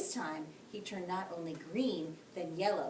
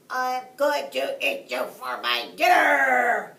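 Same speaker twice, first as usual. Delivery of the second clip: loud, pinched shout through a clothespin; blocked nose voice; 5 semitones higher, 15 dB louder, slower than usual. A narrator's reading. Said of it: "he cried as best he could".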